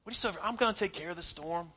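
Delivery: gentle Jesus. Retold or imitated.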